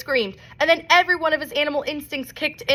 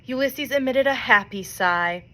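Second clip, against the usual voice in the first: ordinary delivery, nothing marked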